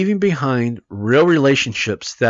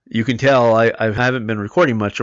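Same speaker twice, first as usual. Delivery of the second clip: hoarse, tired voice; worn-out, hoarse voice; 4 semitones lower than usual. Real, not acted. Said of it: "I'm already losing my voice".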